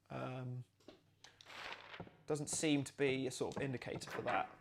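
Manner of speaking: ordinary delivery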